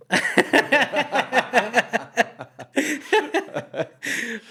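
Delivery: loud rising belly laugh; cracking up at the punchline; long high laugh. Real, not acted. Laughing at the end of the story, loud.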